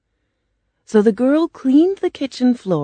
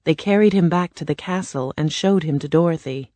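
ordinary delivery

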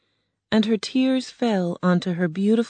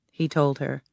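ordinary delivery, nothing marked

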